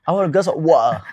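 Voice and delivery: hammed-up British accent, loud, swallowing the end of "water"; British glottal "water", cut to "wa'a"; mock British accent. Interviewee mocks hard-to-follow British speakers, exaggerated British accent. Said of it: "They say".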